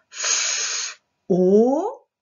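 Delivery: F as a long hiss, then a long loud O; sounding out. Shown as a demonstration. Teacher breaking down a syllable, stretched sound by sound. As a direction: hold F, then hold O separately.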